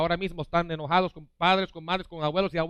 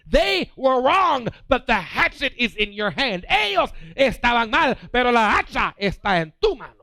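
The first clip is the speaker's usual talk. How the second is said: louder and higher, pounding each phrase; preaching at full force; 6 semitones higher, 7 dB louder than usual. Acted. Preacher pressing the crowd to let go, loud and rising. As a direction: drive it home to the congregation.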